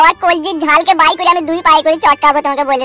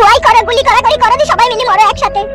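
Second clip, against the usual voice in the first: higher-pitched nagging woman's voice; scolding mother-in-law; 10 semitones higher than usual. Acted. (উঁচু গলায় বকুনি দেওয়া শাশুড়ি)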